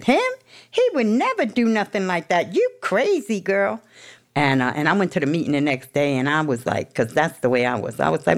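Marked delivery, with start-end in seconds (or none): 0.0-3.8 dismissive coach. Retold or imitated.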